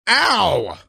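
high-pitched "ow" of pain; acted yelp of pain. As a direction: cry out as if hurt. Improv character's arm gets broken.

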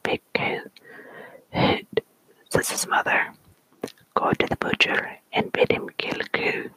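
story poem whispered in a put-on Scottish accent. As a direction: whisper the tale with a playful Scots accent. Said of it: "read it with the proper accent".

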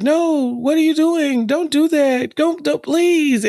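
high, whiny pleading, slowing at the end; hammed-up high plea; mock pleading Oliver. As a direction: beg like a needy child.

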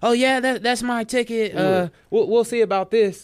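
high-pitched mock-indignant woman; hammed-up accuser up high; imitated accusing lady. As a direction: put on her insistent high voice.

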